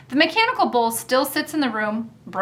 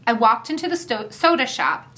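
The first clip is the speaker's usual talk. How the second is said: ordinary delivery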